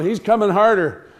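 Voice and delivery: ordinary delivery, nothing marked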